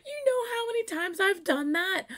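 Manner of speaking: high, loud exclamation; amused outburst. Real, not acted. Fan recognizing herself in video, high excited outburst. Delighted at relatable video moment.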